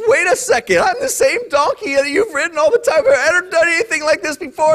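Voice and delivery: high, whiny talking donkey. Acted. Donkey protests to its angry rider, high and whiny. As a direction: pitch up as the wronged donkey. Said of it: "when the donkey says".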